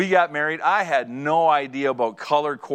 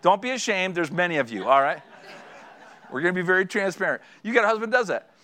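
usual speaking voice

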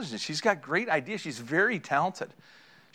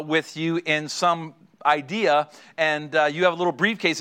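normal talking voice

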